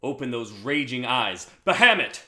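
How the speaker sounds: building to a loud cry on the name; summoning shout. Acted. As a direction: call the dragon forth, rising to a roar. Summoning a dragon.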